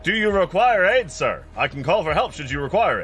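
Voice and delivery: high, loud, courteous attendant voice; automaton exhibit attendant. Acted. Automaton offers aid to fleeing visitors, brightly courteous. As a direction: offer help with unshaken, over-polite service.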